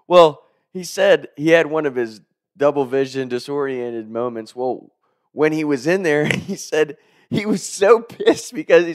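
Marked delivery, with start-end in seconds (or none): none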